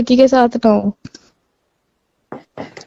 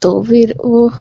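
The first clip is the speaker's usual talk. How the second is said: crying on the phone; slower than usual. Real, not acted. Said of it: "रो मत".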